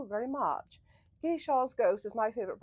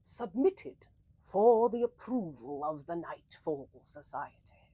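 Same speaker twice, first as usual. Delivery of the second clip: story narration intro; 5 semitones lower than usual. Acted. Announces the story title.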